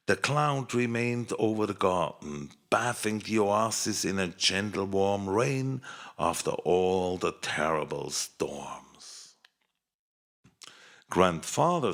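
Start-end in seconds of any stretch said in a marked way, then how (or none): none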